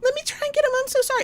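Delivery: high, fast apologetic lady voice; flustered neighbour woman. Retold or imitated. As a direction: rush the apology in a high squeak. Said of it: "the woman came out and was like".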